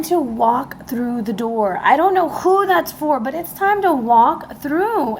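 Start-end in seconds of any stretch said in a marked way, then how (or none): 1.8-5.2 excited. Real, not acted. Urgent message to viewers.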